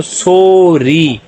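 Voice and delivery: "Sorry" stretched into long "सो" and long "री"; syllable by syllable. Shown as a demonstration. Teacher reading both syllables together, "sorry" slowly drawn out. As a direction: draw out each half of "sorry". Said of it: "दोनों को मिला के पढ़ेंगे".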